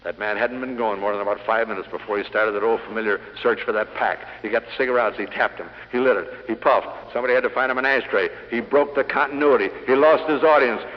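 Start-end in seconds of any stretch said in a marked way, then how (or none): none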